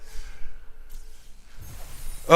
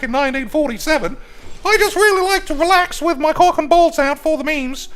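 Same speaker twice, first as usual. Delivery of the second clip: very high, loud comic donor voice; absurdly high-pitched mock fan voice; Professor Wankstein; 5 dB louder than usual. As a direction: squeal it out high and loud like a silly caricature. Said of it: "Professor Wankstein is gonna jump in and be like".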